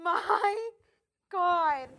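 high, stunned gasping oh my God; shocked. Real, not acted. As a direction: react to a scary sight. Shocked at Connor's face paint.

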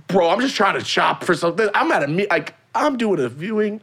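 fed up, pitch climbing high; exasperated. Retold or imitated. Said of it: "I'm like".

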